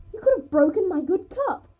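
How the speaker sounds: high, loud, outraged fussing; scolding. A narrator's reading. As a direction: shriek it like a scandalised mother. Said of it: "my mother scolded me".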